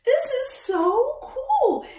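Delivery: high, loud shout of delight; host amazed at painted dinosaur footprints; excited wow. Real, not acted. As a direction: burst out with amazement.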